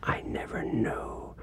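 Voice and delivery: hushed whispered quote; a stunned whisper. Retold or imitated. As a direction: breathe it out in wonder. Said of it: "some of them said".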